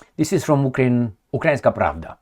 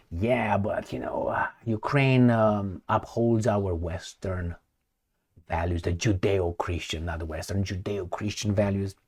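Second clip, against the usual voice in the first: mock excuse; 7 semitones lower, 13 dB quieter than usual. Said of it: "if you tell me".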